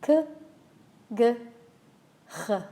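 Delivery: «k», «g», «h» each from a point further down the throat; k g h stepping down. Shown as a demonstration. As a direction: step the sound down from «k» to «h». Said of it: "taking steps down your throat".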